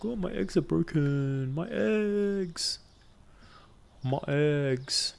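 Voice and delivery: mock whiny eggs wail. Mocking Sasha, hammed-up whiny wail over broken eggs. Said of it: "Sasha can't be like".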